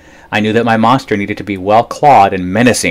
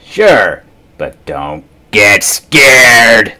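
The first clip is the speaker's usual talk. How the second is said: louder, slow rasp; rasping monster voice; 2 semitones higher, 6 dB louder, much slower than usual. A narrator's reading. The third monster warns the boy, slow and rasping. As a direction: drag it out like a lurking beast.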